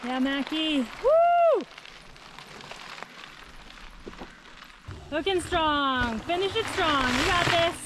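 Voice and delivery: high, excited finish-line cheers; hoarse marathon cheering. Real, not acted. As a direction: whoop them home to the finish.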